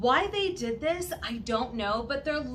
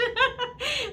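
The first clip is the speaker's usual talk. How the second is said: burst of laughter; 13 semitones higher than usual. Real, not acted. Laughing at getting burnt.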